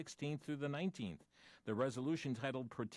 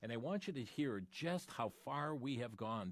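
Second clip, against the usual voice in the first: usual speaking voice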